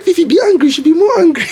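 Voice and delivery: high, squeaky inner voice. Retold or imitated. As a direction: voice the thought playfully high. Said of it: "In my mind, I was like".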